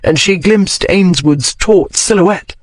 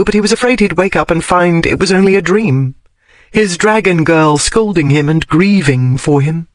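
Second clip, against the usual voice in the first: usual speaking voice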